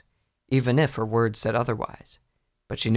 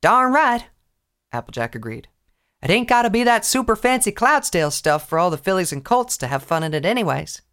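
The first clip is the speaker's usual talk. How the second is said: higher, folksy farm pony; country voice; 9 semitones higher, 5 dB louder than usual. Acted. Applejack voiced by reader.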